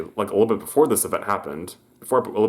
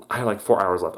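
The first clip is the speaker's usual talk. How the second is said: ordinary talk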